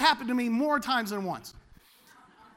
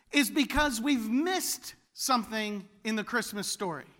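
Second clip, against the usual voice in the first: usual speaking voice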